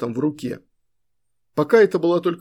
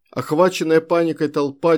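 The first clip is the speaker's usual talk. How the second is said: ordinary delivery, nothing marked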